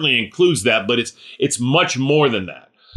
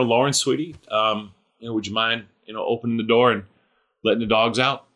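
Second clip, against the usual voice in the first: soft, tender ask to a daughter; gentle sweet request; 3 semitones lower, 4 dB quieter, faster than usual. Shown as a demonstration. Father asking his daughter kindly to let dogs out, gentle and soft. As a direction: coax her sweetly, no pressure. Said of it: "you see how nice that went there".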